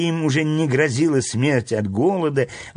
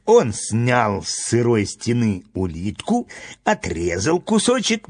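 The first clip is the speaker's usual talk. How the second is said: ordinary delivery, nothing marked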